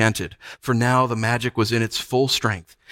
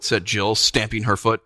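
plain reading voice

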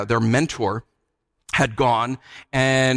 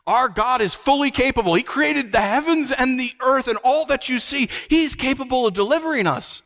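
raised pitch, rising in fervour; impassioned refusal, climbing higher at the end; defiant declaration; 7 semitones higher than usual. Acted. Three faithful men refusing the king, defiant and fervent.

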